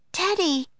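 put-on high-pitched girl calling the dog; Annie crying out. A narrator's reading. Annie calling her lost dog, high cry. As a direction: call out high, falling at the end. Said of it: "cried Annie".

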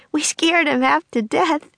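panting between words; out of breath. Acted. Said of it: "trying to catch her breath".

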